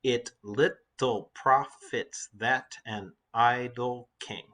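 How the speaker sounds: very slow, each syllable set apart; measured syllable-by-syllable reading; counting out syllables. Shown as a demonstration. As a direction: read it syllable by syllable, "It lit-tle prof-its that an i-dle king". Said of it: "let's count the syllables in the lines".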